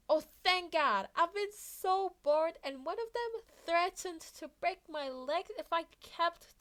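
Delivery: put-on high, bright relieved kid voice; relieved young Izuku. A narrator's reading. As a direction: gush it out, so glad to be rescued.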